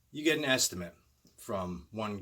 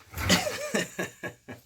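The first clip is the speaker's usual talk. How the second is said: high burst of laughter; 5 dB louder than usual. Real, not acted. Breaks from asmr bit laughing.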